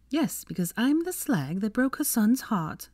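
high, slow sarcasm; bitter retort. A narrator's reading. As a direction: throw it back sarcastically.

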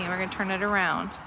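ordinary talk, nothing marked

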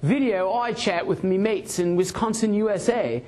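a touch of the London bloke; friendly Brit bragging, mild English accent; British guy. Retold or imitated. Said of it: "and he said".

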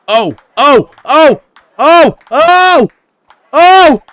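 loud high yelled ohs; escalating loud gaming shouts; shouted oh. Real, not acted. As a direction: yell each oh in disbelief. Gaming reaction, repeated yells.

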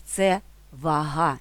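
«вага» said va-HA, slow, stress on the last syllable; наголос на другий склад: вага́; stress on second syllable. Shown as a demonstration. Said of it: "наголос на другий склад".

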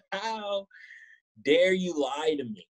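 theatrical hurt, slowed; mock wounded reproach. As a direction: drag it out like a betrayed soap hero. Mock outrage at the catfish.

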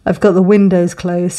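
talking through a yawn. Real, not acted. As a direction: yawn while speaking. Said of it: "so I'm yawning".

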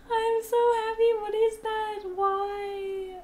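high crying laugh of delight; joyful squeal. Real, not acted. Overjoyed at an extra fox, high squealing laugh. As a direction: squeal almost crying with joy.

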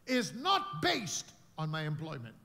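ordinary talk

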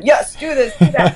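lightly put-on German accent, bossy and laughing; mock German woman. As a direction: play a laughing, half-hearted German boss lady. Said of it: "you sound like a German woman".

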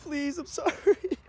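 slow, high, tearful plea; crying. Real, not acted. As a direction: sob it out, scared. Crying after forgetting salt water.

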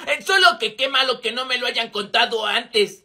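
ordinary delivery

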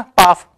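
पाफ with the th turned into an f sound; imitating a UK speaker saying पाफ for path. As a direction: say पाफ, swapping th for f as a UK speaker would. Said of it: "टीएच जिस word में आता है ना उसको वो पाफ कर देते हैं".

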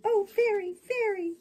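high, loud acted cry for help; puppet screaming. A narrator's reading. Puppet crying to the fairy for help, screaming. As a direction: call out high and loud in a panic. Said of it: "began to scream".

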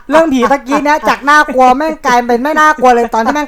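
words bubbling with laughter; giggling about the ghost story being ruined; laughing. Real, not acted. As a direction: laugh through the line. Laughing at a fart during ghost story.

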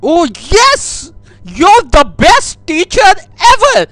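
high, loud overexcited gushing with a cry in it; gushing squeal. Acted. Prank caller gushing at the piano teacher, loud and high. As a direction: squeal with fake delight at the teacher.

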